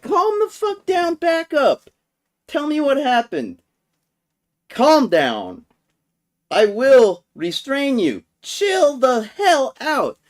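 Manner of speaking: high, forceful mock guard commands; imitated exasperated guard giving orders; guard ordering calm. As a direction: lay down the law to a raging coworker. Retelling guard ordering man down.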